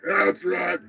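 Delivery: over-the-top country commercial voice; mock radio ad. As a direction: sell the chicken shack like a cheesy local commercial. Hammed-up fake chicken shack ad.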